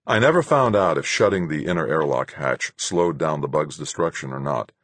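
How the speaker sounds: usual speaking voice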